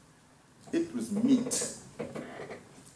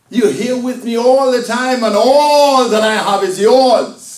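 impassioned protest; 9 semitones higher, 12 dB louder than usual. Acted. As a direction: preach the father's plea at full volume, stretching it out. Father protests to resentful elder son.

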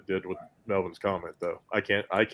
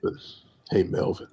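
sexy velvet teddy bear voice. Acted. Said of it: "sexy velvet teddy bear voice".

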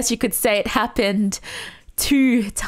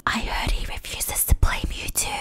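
hushed, voiceless whisper; mock whisper; 4 dB quieter than usual. Player sharing a post with viewers, in a mock whisper. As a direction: whisper it like secret gossip.

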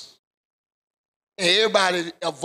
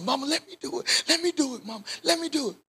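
put-on higher, eager little boy begging; child pleading with mama; 8 semitones higher, faster than usual. Young son begging his mama to carry the tithe.

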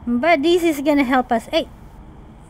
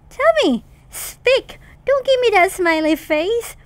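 high, sharp demand; demanding shout; 5 semitones higher than usual. Real, not acted. Yelling at silent friend to answer. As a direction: insist he answers now.

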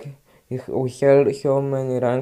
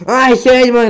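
loud, higher yell of frustration; frustrated yell; 8 semitones higher, 7 dB louder than usual. Real, not acted. Gamer yelling at the game after losing a fight. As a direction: shout it out in real gamer rage.

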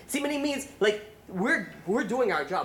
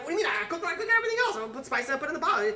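high, frantic mock yelling from a wife; screaming back; 10 semitones higher, faster than usual. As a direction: protest fast in a strained high pitch. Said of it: "she's screaming back at him".

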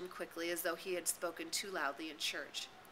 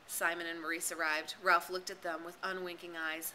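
plain reading voice

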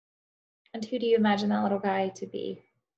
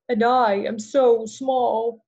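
slow, loud, drawn-out howl; howling wolf; 3 semitones higher, 8 dB louder, much slower than usual. Acted. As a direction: stretch big like a wolf's howl. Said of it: "howled Wolf".

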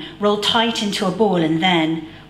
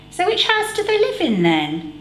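put-on boy voice; 11 semitones higher than usual. A narrator's reading. Stan voiced asking.

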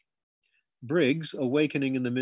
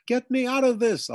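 high, loud panicked bawl; 11 semitones higher, 5 dB louder than usual. A narrator's reading. Boy trapped under his bed bawls for help. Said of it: "I bawled".

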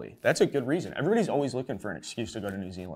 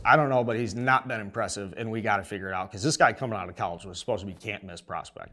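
ordinary delivery

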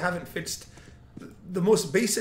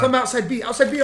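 urgent callout; 7 semitones higher, 4 dB louder than usual. Real, not acted.

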